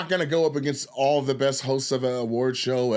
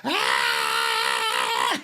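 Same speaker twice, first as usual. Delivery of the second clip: scream; 10 semitones lower than usual. Retold or imitated. Host screaming on stage.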